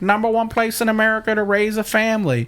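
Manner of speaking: high, sustained tourism-video pitch; hammed-up high promotional voiceover; cheesy promo voice. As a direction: sell the town like a cheesy montage. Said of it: "Probably in that voice, too".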